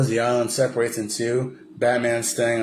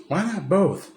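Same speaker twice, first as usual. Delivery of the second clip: high cocky quip; Robin chiming in; 9 semitones higher, slower than usual. Retold or imitated. Robin surprising Batman, lightly voiced high. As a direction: pop in pitched up.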